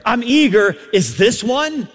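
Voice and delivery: loud, high preacher's voice; impassioned preaching. Real, not acted. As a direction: preach it out at full force. Preaches at full force.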